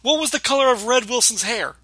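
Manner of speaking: mock angry quiz. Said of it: "he's screaming at him".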